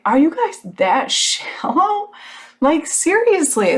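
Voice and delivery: high, slow, shocked question; re-enacting her stunned reaction. She retells confronting the salesmen, shocked and slow. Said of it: "I was just shocked".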